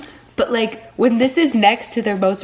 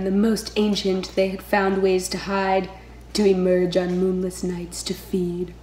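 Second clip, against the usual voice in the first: ordinary talk, nothing marked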